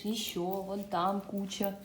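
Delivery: ordinary delivery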